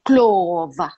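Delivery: Clover in an African accent, long open first vowel; African-accented Clover, falling at the end. Shown as a demonstration. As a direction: say Clover as an African speaker would. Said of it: "saying that with an African accent".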